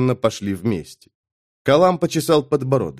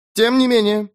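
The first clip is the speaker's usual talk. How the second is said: high, firm dismissal; curt cut-off; 9 semitones higher than usual. A narrator's reading. Adjunct overruling a reluctant player, curt. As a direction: cut off the objection. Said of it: "отрезала адъюнкт".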